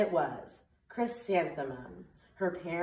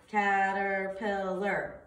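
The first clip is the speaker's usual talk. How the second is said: caterpillar drawn out slowly, syllable by syllable; slow syllable count; much slower than usual. Shown as a demonstration. Teacher counting syllables for students, slow and drawn out. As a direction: stretch caterpillar into four slow beats. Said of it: "let's see".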